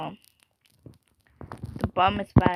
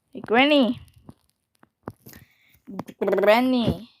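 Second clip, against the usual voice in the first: ordinary talk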